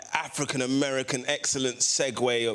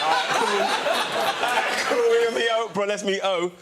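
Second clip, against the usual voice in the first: higher, louder mock-giddy reaction; excited agreement; 6 semitones higher, 5 dB louder than usual. Retelling his reply to meeting Oprah, excited and raised. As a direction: act out being thrilled but playing along.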